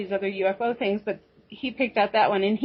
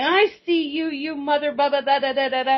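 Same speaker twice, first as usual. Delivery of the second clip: pitched up, half-joking defiance; defiant mock retort; 5 semitones higher than usual. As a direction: throw it back at him, mock tough. Defiant reply to staring alien.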